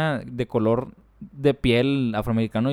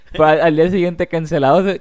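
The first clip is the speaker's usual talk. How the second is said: laughing joke; 5 semitones higher, 9 dB louder than usual. Real, not acted. Joking about cancellation.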